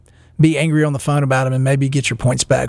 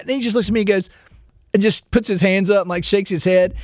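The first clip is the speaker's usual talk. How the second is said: usual speaking voice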